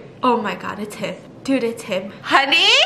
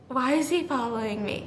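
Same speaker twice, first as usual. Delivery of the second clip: ordinary talk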